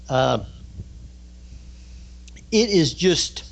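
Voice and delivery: ordinary talk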